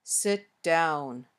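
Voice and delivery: low, falling "Sit down" with a beat between the words; acted calm, low command; low, measured request. Shown as a demonstration. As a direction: keep it low and deliberate. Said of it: "three ways of saying the same request".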